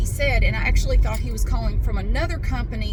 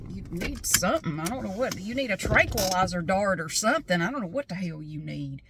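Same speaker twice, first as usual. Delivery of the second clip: much softer, under the breath; muttered retort; 3 semitones lower, 6 dB quieter than usual. Retold or imitated. Customer privately answering the siding guy, quiet grumble. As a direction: grumble privately at someone annoying. Dry inner retort to caller.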